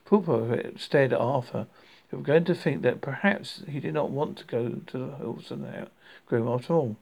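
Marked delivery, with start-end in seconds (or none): none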